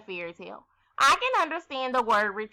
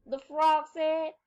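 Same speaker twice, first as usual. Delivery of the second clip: ordinary talk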